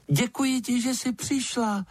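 very high little bat voice, with a smile; smiling bat. A narrator's reading. Bat thanks lizard for coming, smiling high voice. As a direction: thank her in a tiny, high, friendly squeak. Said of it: "usmál se".

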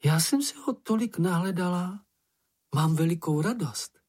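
high, delighted voice; joyful. A narrator's reading. Slečna Ferka thanking for her gift, joyful. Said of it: "celá zežloutla radostí".

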